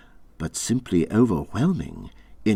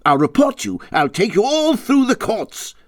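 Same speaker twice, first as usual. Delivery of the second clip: furious tirade; 13 semitones higher, 6 dB louder than usual. Acted. Rat raging at the reckless motorists, loud and high. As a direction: shake your fists and rant. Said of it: "shaking both fists".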